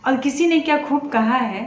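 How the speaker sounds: usual speaking voice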